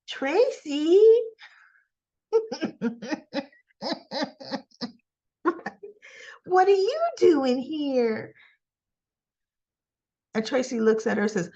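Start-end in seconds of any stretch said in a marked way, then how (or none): 0.1-8.3 high, slow, disbelieving laugh; shocked greeting. Retold or imitated. Felicia stunned to see Tracy walk in, high and laughing. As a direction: gasp out her name in disbelief.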